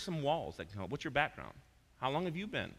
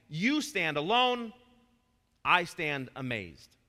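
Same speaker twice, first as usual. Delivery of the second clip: loud, slow, fervent proclamation; loud slow recited worship song; worship lyric recital; 3 semitones higher, 7 dB louder, slower than usual. Acted. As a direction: declaim the hymn as praise. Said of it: "Let me close with [name], a song".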